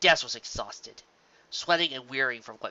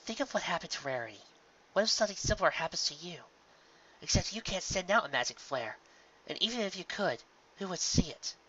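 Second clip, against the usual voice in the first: higher, fast, worried mentor voice; Twilight's voice; 6 semitones higher, 4 dB quieter than usual. Acted. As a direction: warn her quickly and anxiously. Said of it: "The voice was Twilight's".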